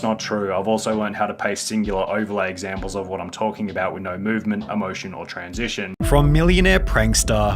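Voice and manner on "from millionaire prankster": loud, slow, hyped documentary voice; dramatic narration. Acted. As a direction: narrate it like a doom-laden exposé. Dramatic commentary narration parody.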